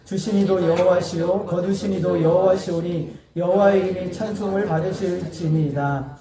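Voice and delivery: slow, solemn reading of scripture. Acted. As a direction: read the verse slowly and reverently. Congregation reads scripture aloud. (천천히 엄숙하게 성경 낭독)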